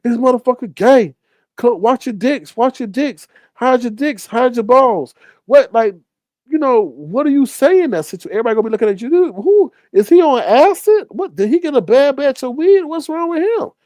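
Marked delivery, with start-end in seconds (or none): 0.0-5.1 high, jokey alarm to teammates; mocking warning. Warning the other players on the court, high mock alarm. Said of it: "when I run around". 9.1-13.7 very high, baffled bystander voices; imitating onlookers. Bystanders watching him lash out, high and baffled.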